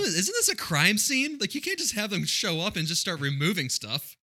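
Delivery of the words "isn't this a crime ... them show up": incredulous. Real, not acted. Incredulous question.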